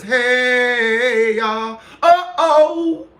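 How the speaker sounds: high, drawn-out singsong; singsong greeting. Retold or imitated. Streamer reads out a viewer's greeting in a high singsong. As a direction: sing out the greeting, held long and high.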